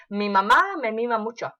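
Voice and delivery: "Mi mamá me mima mucho" fired off quickly, over and over; fast tongue twister. Shown as a demonstration. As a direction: rattle it off at top speed. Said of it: "How fast can you do that?".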